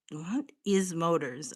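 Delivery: ordinary talk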